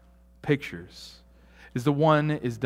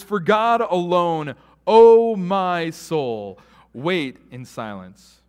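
solemn psalm reading; 4 semitones higher, 8 dB louder, much slower than usual. Acted.

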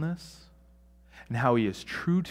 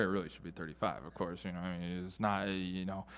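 mock muttered excuse; 7 semitones lower, 13 dB quieter than usual.

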